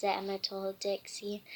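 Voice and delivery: usual speaking voice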